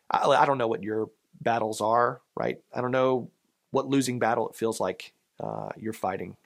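ordinary delivery

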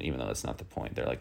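ordinary talk, nothing marked

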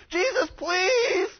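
high, drawn-out acted wail; begging. Freed man begging Jesus, wailing plea. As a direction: plead loudly, stretching out the please. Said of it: "he's begging Jesus and saying".

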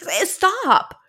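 high, loud acted protest; retold startled shout; alarmed yell. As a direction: fend someone off in a fright. Acting out slapping him away.

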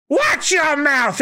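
put-on scolding old lady. Kureha scolding Luffy.

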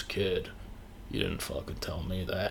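low, soft, gruff Harrison Ford aside; hushed Harrison Ford impression. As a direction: lean over and grumble like Han's actor. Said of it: "I'm doing Mark Hamill doing Harrison Ford".

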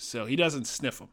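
ordinary talk

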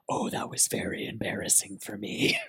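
snubbed fan whispers about her own embarrassment; embarrassed whisper. Retold or imitated. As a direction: whisper it to yourself, mortified. Whispered aside after snub.

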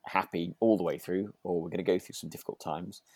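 ordinary talk, nothing marked

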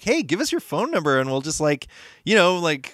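bright upbeat sales pitch, high and quick; salesy company voice. Retold or imitated. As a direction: play the eager brand rep. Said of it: "they were like".

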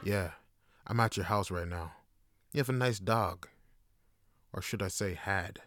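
low, soft menacing phone voice; quietly menacing, as if on the phone; mock threat. Acting out a threatening phone call.

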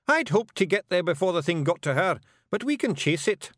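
high, assured Scots voice; confident Scottish Feegle. A narrator's reading. Rob assuring Miss Level they can get inside, confident. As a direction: assert it in high, emphatic Scots.

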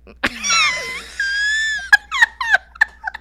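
high shrieking burst of laughter. Real, not acted. Loud laugh.

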